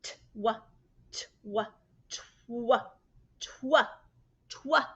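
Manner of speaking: repeated tw sound; repeated twa blend. Shown as a demonstration. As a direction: chant twa, building up.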